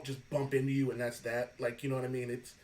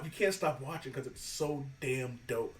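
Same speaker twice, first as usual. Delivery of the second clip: ordinary talk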